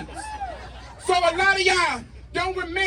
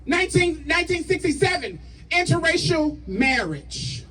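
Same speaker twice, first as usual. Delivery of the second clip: rally speech; 3 semitones higher than usual. Acted. Rally call to action, shouted.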